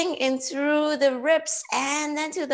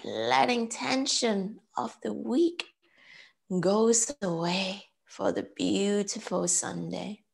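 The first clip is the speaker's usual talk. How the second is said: slow, measured meditation voice with long pauses; guided breathing; 7 semitones lower than usual. Real, not acted. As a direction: count them calmly through each breath, unhurried. Leads breath counting and meditation.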